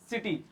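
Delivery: सिटी opening on an S sound; soft C. Shown as a demonstration. Teacher modelling C before I, सिटी with an S sound. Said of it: "क्या बनेगा यहाँ पर?".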